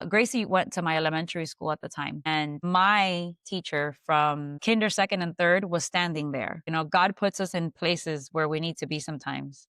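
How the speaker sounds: usual speaking voice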